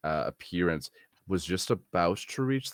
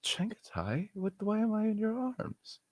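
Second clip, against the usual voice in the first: soft breathy bewildered whisper; confused whisper; 12 semitones higher, 4 dB quieter than usual. Acted. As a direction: whisper as if just waking. Character disoriented, barely speaking.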